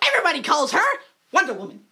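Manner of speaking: usual speaking voice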